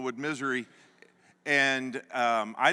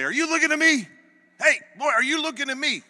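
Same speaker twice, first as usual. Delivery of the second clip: high, loud and fast barking; drill sergeant yelling; 13 semitones higher, 9 dB louder, faster than usual. Retold or imitated. Drill sergeant barks at a recruit on the truck, loud and fast. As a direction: yell it down at the recruit. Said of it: "start yelling at you".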